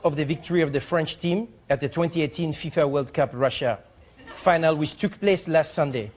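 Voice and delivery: put-on formal letter in French accent; measured, put-on French accent with pauses. Ambassador of France writing about a joke, French-accented formality. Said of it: "read it how I hope he wrote it".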